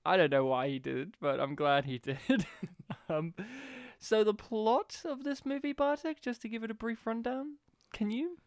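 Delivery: ordinary talk, nothing marked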